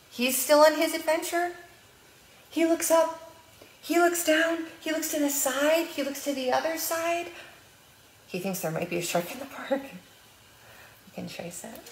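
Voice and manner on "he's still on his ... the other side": high, singsong storytime voice; reading to children. Acted. Librarian reading picture book aloud to preschoolers, singsong. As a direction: read it bright and lilting for little ones.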